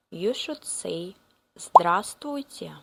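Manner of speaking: ordinary delivery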